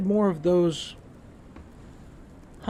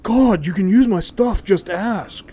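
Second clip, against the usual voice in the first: louder, higher playful scolding; exasperated, pitched up; mock exasperation; 5 semitones higher, 7 dB louder than usual. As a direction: call out a half-joking gripe at a companion. Complains at companion taking his arrows.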